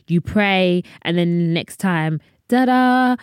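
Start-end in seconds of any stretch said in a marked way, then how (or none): 2.5-3.1 mock ta-da. Mocking instant microwave change.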